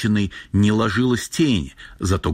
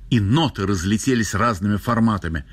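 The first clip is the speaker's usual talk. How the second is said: plain reading voice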